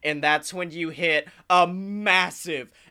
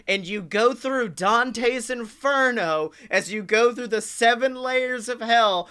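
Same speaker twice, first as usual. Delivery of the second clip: ordinary talk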